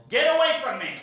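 strained high cry, held back in volume; retold yell. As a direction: imitate a proud mom shooing off help. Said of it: "so she yelled".

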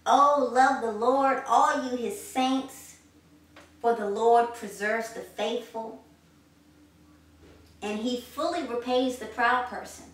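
slow, loud, ringing declamation of the psalm; proclaimed scripture. Acted. Preacher closing the sermon with the psalm, slow and loud. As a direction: bring it home, proclaiming every word. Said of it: "let's just bring it home once again".

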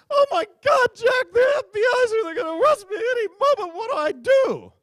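high, loud, frantic falsetto wife; panicked wife voice. Retold or imitated. Comic mimics wife calling husband, high panicked falsetto. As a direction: shriek it like a panicking wife on the phone. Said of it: "the call went something like this".

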